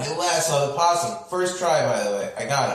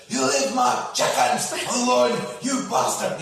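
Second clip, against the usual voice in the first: high, shouted battle cry at the possum; mock Scottish war yell; Scottish warrior scream; 10 semitones higher than usual. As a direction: roar it like a Scottish warrior charging. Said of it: "Start screaming like a Scottish warrior".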